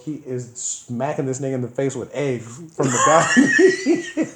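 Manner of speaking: loud, laughing telling; cracking up at the egg attack. Real, not acted. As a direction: shout it through laughter. Laughing shout at the memory.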